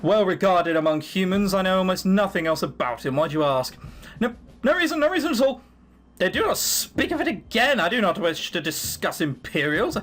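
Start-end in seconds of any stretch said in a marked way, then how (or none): 4.2-5.6 quick, higher denial, covering up; evasive. Acted. Player dodging the dwarf's question, evasive and higher. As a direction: brush off the question, too fast.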